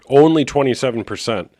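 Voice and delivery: ordinary talk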